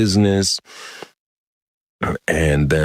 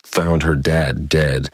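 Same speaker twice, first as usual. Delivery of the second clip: usual speaking voice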